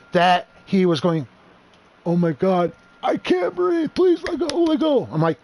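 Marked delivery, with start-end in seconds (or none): 2.0-5.1 high squeaky choking plea; panicked, strangled-sounding falsetto impression; mock terrified. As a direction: imitate a scared boy begging for air in a very high voice. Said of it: "I had him so scared".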